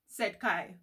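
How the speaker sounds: ordinary delivery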